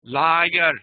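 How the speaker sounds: put-on "lawyer" in an Indian accent, said as "liar" with an open ah. Teacher imitating an Indian speaker saying "lawyer" in an Indian accent. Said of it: "the ah sound that he or she grew up".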